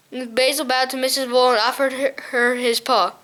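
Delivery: ordinary delivery, nothing marked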